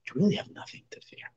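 a hushed, comforting whisper; soft hush. Real, not acted. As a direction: whisper it gently, almost no voice. Reassuring the listener in a hush.

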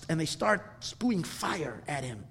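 usual speaking voice

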